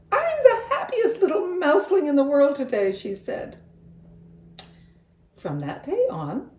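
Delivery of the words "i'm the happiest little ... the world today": high, loud happy squeal; bursting with happiness; overjoyed. A narrator's reading. Angelina hugs her mother, high and overjoyed.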